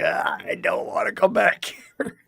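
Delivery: slow drawn-out whine of protest; mock complaining shout. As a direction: groan it like you never want to return. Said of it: "I'm like".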